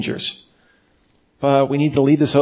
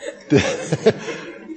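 soft, breath-heavy chuckle; laugh; 4 dB quieter than usual. Real, not acted. Speaker amused by his own handsome-man description, chuckling laugh. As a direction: chuckle helplessly at your own aside.

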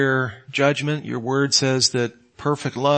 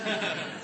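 short laugh; 6 semitones higher, 13 dB quieter than usual. Real, not acted. Laughs at own jab.

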